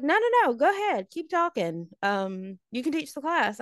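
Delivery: high-pitched mock teacher insistence; imitating a sarcastic teacher; goading teacher. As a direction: egg the student on in a high voice. Retold teacher goading the talkative student.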